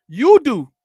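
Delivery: loud, high, pointed jab; sharp shout. Real, not acted. Streamer shouting back at a commenter, loud jab. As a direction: throw it back at them hard.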